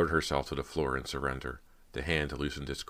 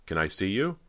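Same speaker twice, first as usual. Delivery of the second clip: higher, pleading question; small, frightened; 5 semitones higher, 4 dB louder than usual. A narrator's reading. Girl asking hidden sister to appear, small and frightened. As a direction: ask with a touch of fear, rising at the end. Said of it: "a voice came out small and frightened".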